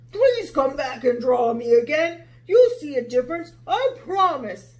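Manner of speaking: lion pleading with Sarah. Acted. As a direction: beg her to come back.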